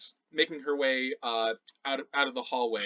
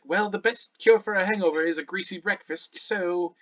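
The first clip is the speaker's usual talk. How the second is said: higher helpful voice, ending on a lifted word; lightly voiced Shannara, matter-of-fact; 5 semitones higher, 3 dB louder than usual. Shannara prescribing breakfast for a hangover, helpful. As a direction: advise helpfully, then float up on the last word.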